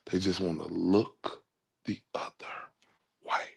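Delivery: [name] voice. Retold or imitated. Streamer mocking those who ignore him, as [name]. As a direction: slip into a light [name] impression. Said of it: "[name] voice".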